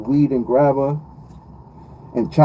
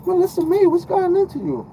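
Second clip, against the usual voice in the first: much higher, squeaky mock Southern twang; mimicking Melissa calling herself by her full name; mock Southern girl voice. To Melissa, imitating how her friends back home will call her, in a high mock twang. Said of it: "She gonna be like".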